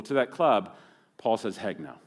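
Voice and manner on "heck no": deadpan denial. Pretend. Paul's answer, dropped low.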